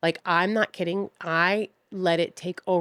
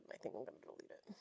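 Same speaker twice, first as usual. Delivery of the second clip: whispered aside; 5 semitones lower, 26 dB quieter, faster than usual. Real, not acted. Muttering about deleting the episode.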